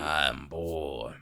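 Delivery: low grunting boar voice; wild boar. Acted. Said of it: "doing Boar's voice kinda hurts".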